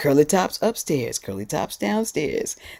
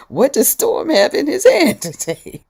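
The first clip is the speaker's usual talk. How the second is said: high, louder question through laughter; laughing loudly at a guest's gadget; 13 semitones higher, 8 dB louder than usual. Real, not acted. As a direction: giggle it out, delighted. Amused by object on screen.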